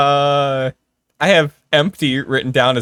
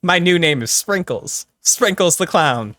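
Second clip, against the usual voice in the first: silly clown; 6 semitones higher than usual. Acted. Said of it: "it's not [name] here".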